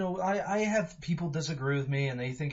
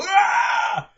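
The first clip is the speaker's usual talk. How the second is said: sudden loud yelp, far higher and louder; imitated shout; 13 dB louder than usual. Reviewer imitating the actor's rare outburst, loud high yell. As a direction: blurt out a brief pained cry in imitation. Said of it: "occasionally hear him go".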